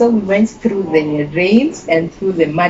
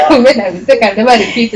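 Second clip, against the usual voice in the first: rushed, laughing mock apology; giggling through a promise; 5 semitones higher, 5 dB louder, much faster than usual. Teen laughing her apology to grandmother.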